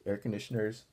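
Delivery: plain reading voice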